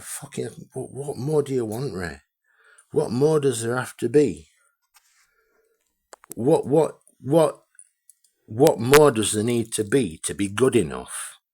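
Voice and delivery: real exasperated yell; angry outburst. Real, not acted. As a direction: shout the question at him in frustration. Furious rant at Raymond.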